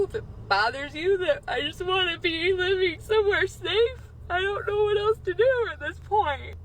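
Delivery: high, crying voice; tearful plea. Real, not acted. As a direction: plead through real tears, pitch climbing. Real plea, breaking into tears.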